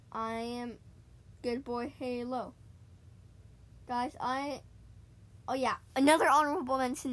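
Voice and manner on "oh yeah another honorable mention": louder and fast, remembering something; sudden excitement. Real, not acted. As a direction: blurt it out as it comes to mind.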